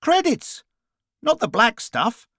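high, exasperated exclamation. A narrator's reading. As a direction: voice shoots up to correct them. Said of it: "shifting markedly upward in pitch".

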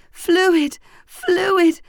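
high, loud howl of a hangover. A narrator's reading. Hangover voice howling at the narrator to drink. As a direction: howl it out like a pounding headache. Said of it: "my hangover voice that was howling".

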